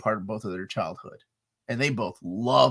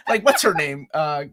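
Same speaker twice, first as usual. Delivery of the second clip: normal talking voice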